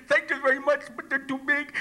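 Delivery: high, garbled talk through teeth too big; oversized false teeth. Acted. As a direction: squeak it past a mouthful of huge dentures. Speaker with oversized dentures.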